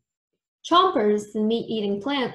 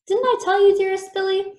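sweetly teasing; 9 semitones higher, 5 dB louder than usual. A narrator's reading. Creepella's sugary reproach.

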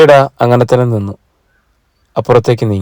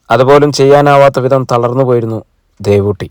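plain reading voice

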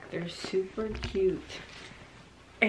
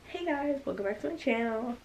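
lightly put-on vlogger greeting, higher and softer; mock vlog intro; 5 semitones higher, 5 dB quieter than usual. Vlogger demonstrating her intro to viewers, soft higher mock greeting. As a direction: practice a channel intro, soft and lifted. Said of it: "I can never do my intro".

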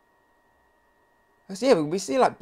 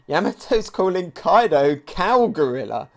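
usual speaking voice

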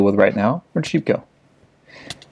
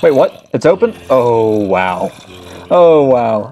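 surprised; 5 dB louder, slower than usual. Real, not acted. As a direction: caught off guard, voice jumps up. Sudden surprise in the game.

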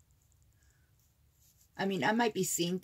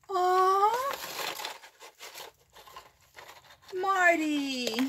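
high, loud drawn-out aww; overwhelmed and gushing; touched delight; 11 semitones higher, 6 dB louder, much slower than usual. Real, not acted. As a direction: squeal with delight at a friend's gift. Delighted reaction to a gift.

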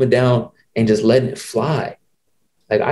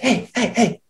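high, mocking call of an annoyed coach; hammed-up high coach voice; imitated coach; 12 semitones higher, 3 dB quieter than usual. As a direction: bark it like the coach snapping at him. Imitating coach calling him out.

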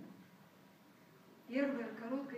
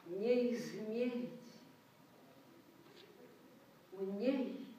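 very slow, drawn out, swelling louder on key words; solemn poetry recital; 4 dB louder, much slower than usual. Acted. Reciter to the concert audience, slow and solemn. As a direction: declaim it like a vow to the nation.

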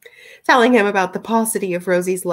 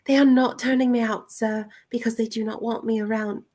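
higher, careful letter-reading voice; Rosie's letter voice; 4 semitones higher than usual. Acted. Rosie writing that she is unwanted, higher formal voice. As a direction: voice a formal young woman's letter.

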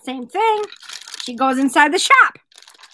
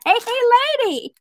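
high bird-voice callback; talking parakeet; 8 semitones higher, faster than usual. Acted. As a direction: squawk the punchline catcall. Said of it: "Hey, lady" right there".

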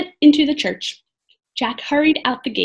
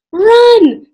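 very loud, high yell; crying out; 11 semitones higher, 12 dB louder than usual. A narrator's reading. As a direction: scream the order to flee. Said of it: "cried Annie".

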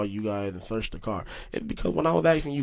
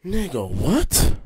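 slow, drawn-out disbelief, louder and rising; incredulous; 4 semitones higher, 5 dB louder, much slower than usual. Retold or imitated. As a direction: stretch it into a stunned question. Retold disbelief at marijuana claim.

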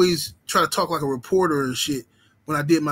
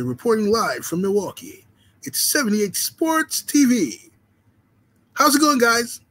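mock TV reporter; 6 semitones higher than usual. Reading a chat joke about his old reporter persona, hammed-up. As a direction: sell it like a cheesy live sports reporter.

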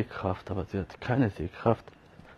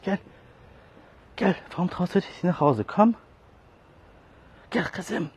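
high pet talk; 8 semitones higher, 4 dB louder than usual. Real, not acted. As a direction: gush over the lost cat in a high voice. (hohe, laute Babysprache zur Katze)

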